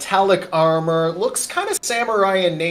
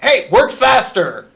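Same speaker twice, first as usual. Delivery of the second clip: louder, higher guard yelling to hurry; barked order; 7 semitones higher, 8 dB louder than usual. Acted. Overseer shouting at the prisoners to work faster, louder and higher. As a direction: snap at the workers to move. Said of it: "you feel a smack on the back of your head and, like".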